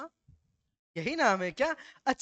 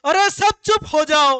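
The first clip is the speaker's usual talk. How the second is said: high, loud, slow yell for silence; loud shout; 6 semitones higher, 12 dB louder, slower than usual. Retold or imitated. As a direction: bellow at the whole room to shut up. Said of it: "जोर से बोल".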